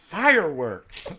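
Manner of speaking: slow, lightly voiced villain exclaiming; Cheshire's gleeful cry. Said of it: "and Cheshire goes".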